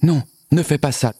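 high-pitched, anxious plea; pleading. A narrator's reading. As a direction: beg the monster to stop. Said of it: "le supplia Liane".

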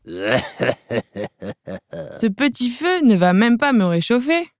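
slow, drawn-out taunt; mocking. Viking mocks the little dragon's flame, slow and sneering. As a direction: sneer it out slowly. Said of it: "Mais le Viking se moque".

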